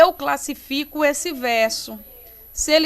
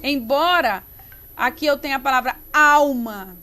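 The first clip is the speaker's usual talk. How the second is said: ordinary talk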